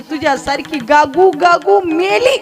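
hammed-up wail over a dead goat; imitated weeping. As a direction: drag out the grief for comic effect. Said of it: "आईनं अजून रडायला सुरुवात केली".

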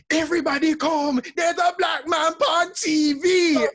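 loud, high imitation of granddad calling the house; granddad's accented shout. Grandchild imitating granddad summoning the family downstairs to the TV, shouting. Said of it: "he would shout out".

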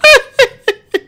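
sudden shrieking laugh; high burst of laughter. Real, not acted. Gamer shrieks with laughter at his friend. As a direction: break into a high cackle.

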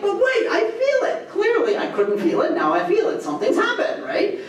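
laughing, high squeal of mock discovery; mock amazed realization. Scholar hamming up feeling it, laughing. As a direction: giggle through a sudden fake insight. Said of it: "a Shirazi is like".

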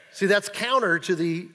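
ordinary delivery

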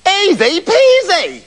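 high, drawn-out singsong boast; cocky eldest son. A narrator's reading. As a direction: toss it off slowly, high and cocksure. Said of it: "said the eldest".

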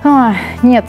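ordinary delivery

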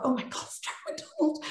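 high, hushed starstruck gasp; awed little squeal under the breath. Retold or imitated. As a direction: squeal it softly, as if nearly fainting. Retelling her starstruck reaction on phone.

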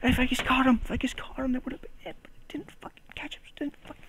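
a furious whisper, rising in pitch; frustrated whisper. Real, not acted. Juggler cursing his missed catch, whispered and frustrated. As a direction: hiss the regret through your teeth.

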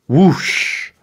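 whooshing swoop. A narrator's reading. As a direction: imitate a sudden swoop through the air. Sound effect of dragonfly swooping.

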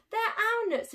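high indignant exclamation; exclaim. Acted. Bruce exclaiming as the bird steals the nuts, high. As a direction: protest it, high. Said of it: "exclaimed Bruce".

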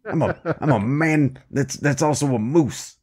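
lightly voiced Moose Man; a touch of a daft Moose Man voice. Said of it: "he's like".

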